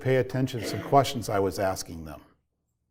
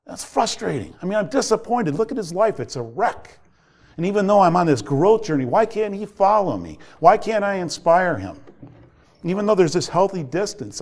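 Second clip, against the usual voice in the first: higher-pitched, exasperated dad reliving his anger; frustrated venting; 6 semitones higher than usual. Retold or imitated. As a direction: plead and scold in one rising-pitched rush of frustration. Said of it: "I was frustrated".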